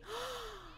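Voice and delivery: high, quick intake of breath; delighted gasp. Real, not acted. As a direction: gasp in happy surprise. Sees the child's new look.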